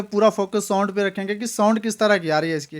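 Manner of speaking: normal talking voice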